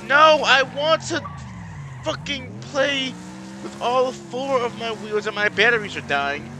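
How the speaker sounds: high, loud, slow wail; distraught yelling. Real, not acted. Racer who lost a wheel, distraught yelling. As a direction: protest loudly at the loss.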